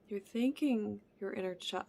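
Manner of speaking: ordinary talk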